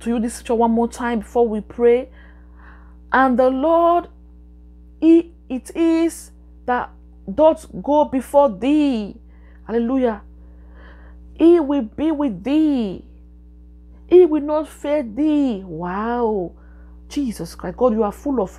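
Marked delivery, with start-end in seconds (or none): 3.1-9.1 slow, solemn reading of the verse; scripture reading. Real, not acted. Reads scripture slowly. 11.3-15.6 slow, solemn reading of the verse; scripture reading. Real, not acted. Reads scripture slowly.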